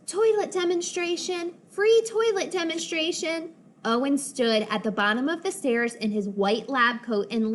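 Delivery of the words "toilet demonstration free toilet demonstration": high, sing-song sales call. Acted. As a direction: call out the offer like a barker. Owen calling out the offer.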